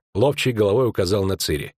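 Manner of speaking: plain reading voice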